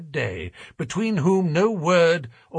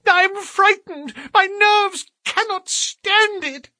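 high, loud, trembling terror; blubbering with fear; 5 dB louder than usual. A narrator's reading. Thaddeus confessing his fear to Holmes, high blubbering terror. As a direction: near tears, nerves gone. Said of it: "half blubbering with fear".

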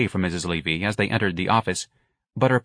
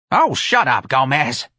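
put-on higher, louder old-lady snap; crabby old woman; 4 semitones higher, 5 dB louder, slower than usual. A narrator's reading. Old clerk snapping at the manager.

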